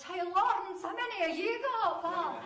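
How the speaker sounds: loud, high playground heckle across the classroom; shouted taunt. Retold or imitated. Classmate Davy heckling her across the classroom, loud and high. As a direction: bellow it across the room to mock her. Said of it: "he shouts".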